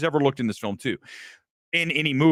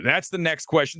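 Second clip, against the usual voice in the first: ordinary talk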